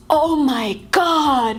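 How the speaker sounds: drawn-out, slow amazed cry; amazed reaction. Shown as a demonstration. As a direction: stretch it out in awe at great news. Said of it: "you react in this way".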